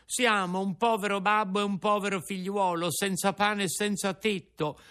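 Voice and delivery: a hint of a poor young puppet; pleading Pinocchio. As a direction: ask for shelter meekly. Pinocchio answers.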